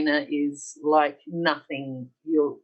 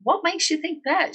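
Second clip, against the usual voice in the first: incredulous question; 11 semitones higher, 4 dB louder than usual. Retold or imitated. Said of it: "I said".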